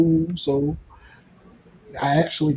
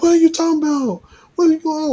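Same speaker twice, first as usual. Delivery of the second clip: much higher, fast, acting shocked; imitating her disbelief, pitched way up; incredulous girlfriend voice; 13 semitones higher, much faster than usual. Girlfriend's indignant reply retold.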